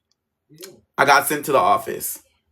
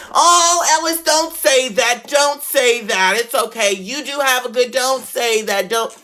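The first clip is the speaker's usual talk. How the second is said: put-on pleading old teacher; 7 semitones higher, slower than usual. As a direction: gently beg him not to say it, much higher. Old teacher scolding softly.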